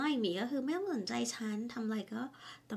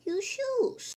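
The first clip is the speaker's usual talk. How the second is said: high, louder wife tossing it back; higher wife voice; 13 semitones higher, 6 dB louder than usual. Acted. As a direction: leave the choice to him, loud and high. Wife answers in couple skit. (ภรรยาโยนให้เลือกเสียงสูงดัง)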